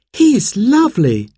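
put-on high, delighted little girl; child's voice. Sarah patting the old dog, high delighted child's voice. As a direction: coo it high like a charmed child.

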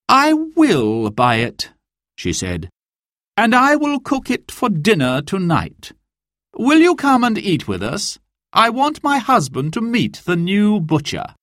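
high, gracious lady's voice; sheriff's wife inviting the butcher to dinner. Acted.

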